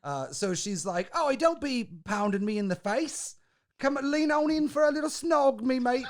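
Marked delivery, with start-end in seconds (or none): 1.1-6.0 hammed-up high Cockney; Cockney accent. Woman luring attacker in for a kiss, mock Cockney. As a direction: play her as a comic, lilting Cockney. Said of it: "gets the Cockney accent".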